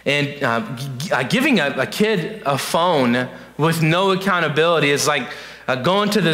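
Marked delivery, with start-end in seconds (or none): none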